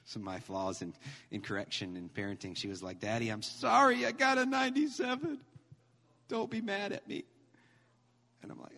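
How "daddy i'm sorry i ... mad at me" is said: put-on high-pitched, plaintive child voice; imitated upset little girl, much higher; pleading little daughter. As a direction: squeak out a child's worried apology. Acts out his crying daughter apologizing.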